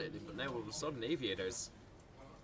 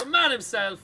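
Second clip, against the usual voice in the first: excited goal call; 13 semitones higher, 16 dB louder than usual. Real, not acted.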